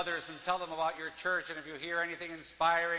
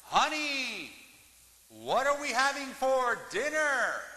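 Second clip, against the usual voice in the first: higher, louder calling out, slow; retold shout; 7 semitones higher, 4 dB louder, slower than usual. Husband shouts to wife from downstairs, raised call. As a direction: call across the house. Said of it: "and shouted".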